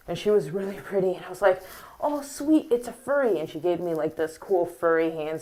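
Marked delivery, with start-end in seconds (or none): 2.0-3.4 high-pitched retold delight; delighted quote. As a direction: act out a happy, starstruck discovery. Retelling delight at spotting a furry.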